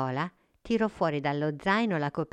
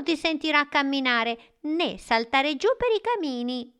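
lightly voiced eager boy; a hint of an excited child; 9 semitones higher, 4 dB louder than usual. The boy gives gifts. (voce da bambino)

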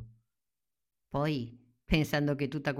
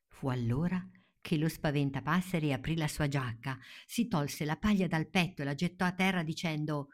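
ordinary delivery, nothing marked

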